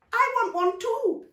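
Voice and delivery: put-on high, loud, slow little boy; child demanding. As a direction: insist like a left-out kid. Nico demanding.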